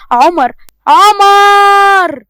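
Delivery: long, drawn-out, loud calling of his name; exasperated call. Acted. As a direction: stretch out "عمر، عمر" loud and high, fed up. فرح تنادي عمر بزهق. (نداء طويل وعالي بزهق)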